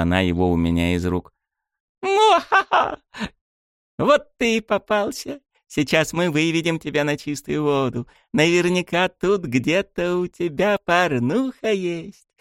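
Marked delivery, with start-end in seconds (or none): 2.0-3.0 high, hammed-up villain cackle; mock evil laugh. 4.0-12.3 slow, high hammed-up villain voice; mock villain gloating.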